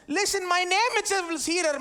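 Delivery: voice of God. Acted. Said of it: "So when God says".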